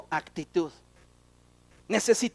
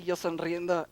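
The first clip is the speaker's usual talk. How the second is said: usual speaking voice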